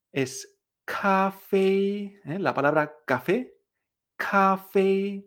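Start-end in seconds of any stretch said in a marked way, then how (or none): 0.8-2.2 CAFÉ con dos sílabas altas, planas y largas; sostén CAFÉ en una sola nota aguda; tono uno uno. Shown as a demonstration. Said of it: "El tono uno uno". 4.2-5.2 CAFÉ con dos sílabas altas, planas y largas; sostén CAFÉ en una sola nota aguda; tono uno uno. Shown as a demonstration. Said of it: "El tono uno uno".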